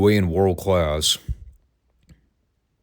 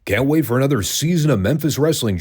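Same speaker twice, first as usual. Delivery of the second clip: higher, salesy TV executive pitch; cheesy TV exec; 6 semitones higher than usual. Retold or imitated. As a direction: ham it up like an eager exec. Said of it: "came up to them and said".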